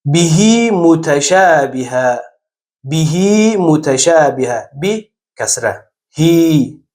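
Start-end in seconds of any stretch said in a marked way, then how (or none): 0.1-4.7 «بهی متشابهه» slowly, the هی in بهی drawn long as hee; stretched hi. Shown as a demonstration. Teacher demonstrates the stretched pronoun hi, slow.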